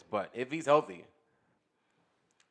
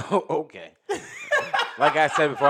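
talking through big laughter; laughing; 4 semitones higher, 8 dB louder than usual. Real, not acted. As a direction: laugh it out loudly. Laughing after ribbing.

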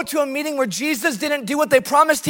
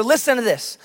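ordinary talk, nothing marked

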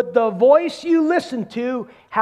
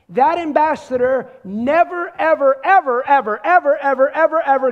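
each ever a little louder, building up; building emphasis; 3 semitones higher, 4 dB louder than usual. Real, not acted. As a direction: hammer every ever harder. Preacher stacking repeated evers.

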